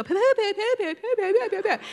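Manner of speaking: high-pitched imitation of a kid's puffing babble; silly nonsense talk. Granddaughter speaks in character during the story, silly babble. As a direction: chatter in repeated nonsense syllables. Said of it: "You talk like this".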